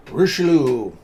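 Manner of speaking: bright loud call-out to a viewer; loud greeting. Real, not acted. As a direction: shout the name across the room. Greeting a chat viewer loudly.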